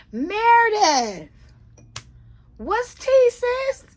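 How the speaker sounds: high, drawn-out shriek of a name; shrieking call. Real, not acted. Host shrieking at Meredith for gossip. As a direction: call her out at the top of the voice.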